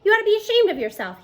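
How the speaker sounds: high, loud, fast accusation; teacher accusing the coach, worked up and angry. Acted. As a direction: fire it at him, worked up. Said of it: "he accuses Coach Slattery".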